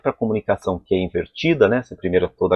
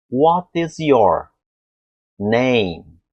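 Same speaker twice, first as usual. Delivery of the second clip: slow, deliberate What is your; each word of what is your name drawn out; the slow articulation stage; slow form; 2 semitones higher, much slower than usual. Shown as a demonstration. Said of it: "Falam pra mim assim".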